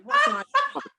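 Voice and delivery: put-on high, loud kid's whine; childish demand. Boy demanding from Fraser, high loud whine.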